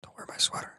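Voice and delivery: hushed whisper; very soft, whispered interjection; whispered aside. Real, not acted. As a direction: whisper it under the main conversation. Private aside during the talk.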